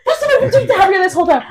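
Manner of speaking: high, fast, loud laughing cry; laughing outburst. Real, not acted. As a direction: blurt it out mid-laugh.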